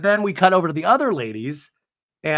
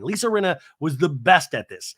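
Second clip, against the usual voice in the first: ordinary talk, nothing marked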